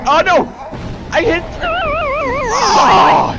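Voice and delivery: high startled yell. Real, not acted. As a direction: cry out in alarm. Sudden danger in game.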